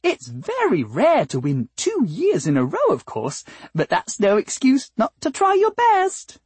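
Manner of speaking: high, swooping, over-sweet lilt; sweet flower singer. Acted. Flower making polite talk about the contest, high and sugary. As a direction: chirp it politely, soaring at the end.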